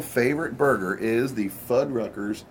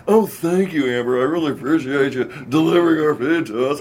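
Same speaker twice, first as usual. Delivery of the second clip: same put-on voice; 3 semitones higher, 5 dB louder than usual. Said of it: "I'm like".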